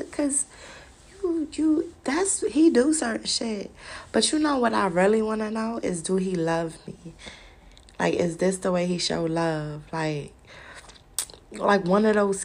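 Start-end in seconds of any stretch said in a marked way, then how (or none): none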